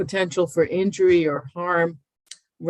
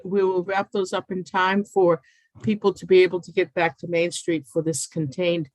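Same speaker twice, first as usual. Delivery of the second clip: usual speaking voice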